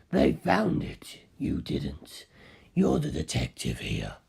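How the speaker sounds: deep low voice for Carl contradicting the detective; Carl's voice. Acted. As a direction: drop the voice far down and take it slowly.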